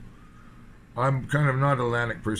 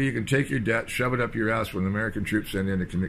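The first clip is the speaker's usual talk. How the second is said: ordinary talk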